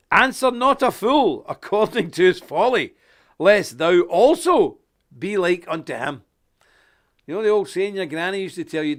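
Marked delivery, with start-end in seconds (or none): 0.1-6.2 loud, slow solemn Bible reading; scripture reading. Real, not acted. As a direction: proclaim the verse. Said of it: "let me read a wee bit of scripture".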